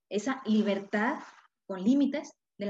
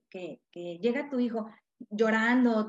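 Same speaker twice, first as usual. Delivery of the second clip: ordinary talk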